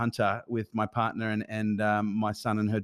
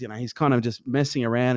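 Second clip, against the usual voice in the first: ordinary talk, nothing marked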